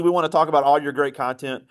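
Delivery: ordinary talk, nothing marked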